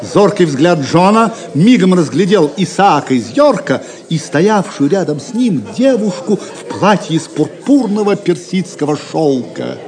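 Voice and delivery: ordinary talk, nothing marked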